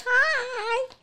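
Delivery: high, thin voice of a giant crab; high squeaky crab voice. Pretend. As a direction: squeak out a tiny hello. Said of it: "they said".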